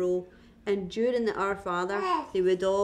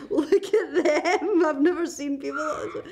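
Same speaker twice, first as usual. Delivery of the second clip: slow, higher, gawking wonder with a laugh; mock awe; 7 semitones higher, 4 dB louder than usual. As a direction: stretch it out like you're staring wide-eyed. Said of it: "I'd just be like".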